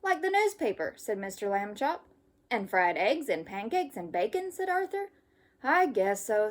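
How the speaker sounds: lively storybook read-aloud; reading to children. Acted. Teacher reading a camping story to kids. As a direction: read it brightly for young listeners. Reads picture book to children.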